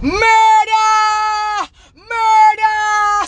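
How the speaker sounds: high, loud wailing shout; long high cry of murder; outraged scream. Real, not acted. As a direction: scream it like raising the alarm.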